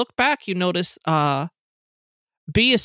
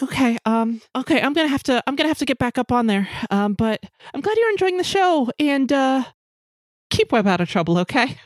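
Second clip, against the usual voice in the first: higher-pitched, polite goodbye in character; friendly, hurried singer; 6 semitones higher than usual. Acted. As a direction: excuse yourself to get back on stage, pitched up. Game master voices B leaving.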